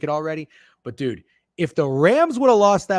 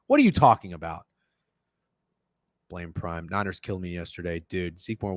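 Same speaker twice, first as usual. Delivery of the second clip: ordinary delivery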